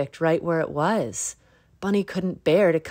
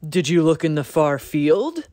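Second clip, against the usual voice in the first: louder deer voice, rising question; 7 dB louder than usual. A narrator's reading. Deer keeps quizzing Bunny, louder. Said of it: "asked Deer".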